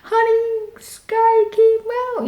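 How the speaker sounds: high frantic screaming; high, fast, frantic call. Retold or imitated. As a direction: yell it to the husband in panic. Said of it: "I was screaming".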